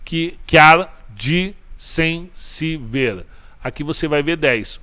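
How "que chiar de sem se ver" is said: each syllable drawn out and separated; slow syllable scansion. Shown as a demonstration. Teacher scanning a Camões verse for the class, slow and syllable by syllable. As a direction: spell the verse out slowly, beat by beat. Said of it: "teacher scans the verse syllables".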